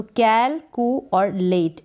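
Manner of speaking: "कैलकु और लेट" slowly, chunk by chunk; teacher separating कैल, कु and लेट; broken into pieces. Shown as a demonstration. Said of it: "तीन टुकड़ों में इसका". ("कैलकु और लेट" धीरे-धीरे तोड़कर)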